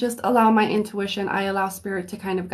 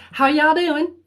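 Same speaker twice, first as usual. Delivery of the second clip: put-on playful southern accent; 6 semitones higher, 4 dB louder than usual. Host greeting her viewers in a playful Southern accent. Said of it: "feeling a little southern".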